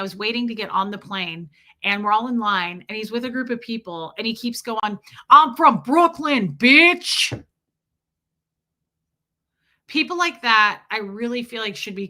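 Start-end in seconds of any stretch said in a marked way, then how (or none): 5.3-7.4 loud, high, drawn-out Brooklyn brag; Brooklyn accent. Retold or imitated. Imitating a loud guy boarding a plane, mock Brooklyn accent. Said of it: "I did the Brooklyn accent".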